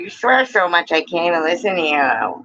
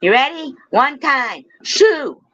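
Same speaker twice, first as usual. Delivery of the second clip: high, excited hype-man call; 10 semitones higher, slower than usual. Acted. Streamer hyping chat to answer, high call. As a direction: fire up the crowd for the response.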